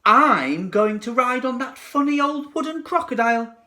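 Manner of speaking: put-on high, loud little girl. A narrator's reading. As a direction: Jill choosing the crocodile.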